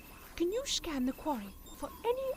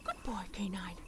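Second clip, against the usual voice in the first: soft unvoiced whisper; 8 semitones lower, 6 dB quieter than usual. Sarah Jane praising K9 at night, whispering. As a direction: whisper so nobody wakes. Late night, quiet house.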